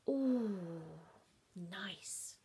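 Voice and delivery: savouring. Retold or imitated. Tasting crumbs.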